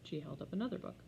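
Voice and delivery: usual speaking voice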